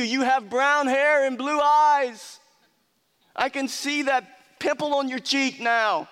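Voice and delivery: exasperated mockery. Preacher voicing the healed man taunting Pharisees, mocking. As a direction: rub their nose in the obvious. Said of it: "It's like".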